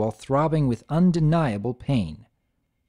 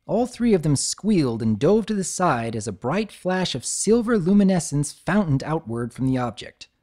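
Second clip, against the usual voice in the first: ordinary delivery, nothing marked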